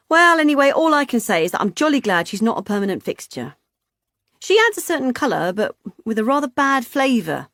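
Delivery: high, loud jolly chatter; jolly hearty woman. Acted. Vicky dismissing the girl to the couple, jolly and loud. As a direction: bluster on cheerfully.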